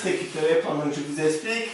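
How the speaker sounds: ordinary delivery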